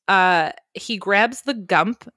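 ordinary talk

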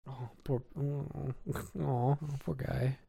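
very soft, cooing sympathy; mock pity. Co-host pitying the deformed Dalmatian, mock tenderness. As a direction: murmur it slowly as if pitying a sad puppy.

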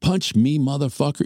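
high-pitched imitation of her demand; intense demand. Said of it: "getting intense with me".

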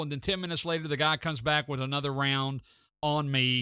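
normal talking voice

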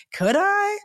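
very high rising question; doubtful wondering. Retold or imitated. Told to start a business, high tentative wondering. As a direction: lift it high, tickled by the idea.

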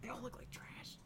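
soft, breathy whisper; quiet but clear. Real, not acted. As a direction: whisper it under your breath. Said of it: "[name], you sound really quiet".

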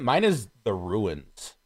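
ordinary talk, nothing marked

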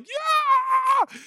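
high shout of joy for the dog; excited yelp. Retold or imitated. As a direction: cheer like an overjoyed puppy.

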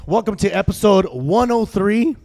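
loud, upbeat show opener; show host intro. Acted. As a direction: hype up the show intro, drawing out names. Host restarting the episode.

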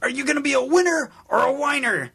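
hammed-up high preacher taunt; preacher impression. Quoting the preacher.

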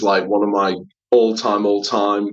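ordinary delivery

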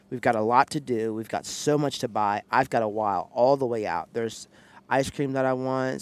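usual speaking voice

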